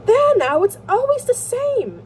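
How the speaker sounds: high, loud, drawn-out complaint; exclaimed interruption. A narrator's reading. Koshnev interrupts his brother, loudly exasperated. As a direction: cut in loudly, pitched right up. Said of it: "interrupted Koshnev".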